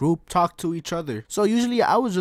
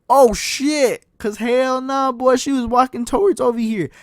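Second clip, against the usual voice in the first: panicked; 8 semitones higher, 8 dB louder than usual. Retold or imitated. Teacher walking over.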